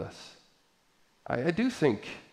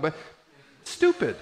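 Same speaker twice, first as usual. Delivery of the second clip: ordinary delivery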